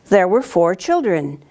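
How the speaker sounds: ordinary delivery, nothing marked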